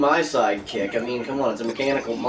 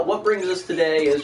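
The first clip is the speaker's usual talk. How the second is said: normal talking voice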